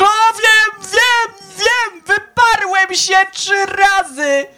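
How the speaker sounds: Peter's anguished answer. Acted. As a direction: cry it out as Peter caught in his denial. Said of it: "A Piotr". (Piotr odpowiada Jezusowi, wyżej, głośno i powoli, z bólem)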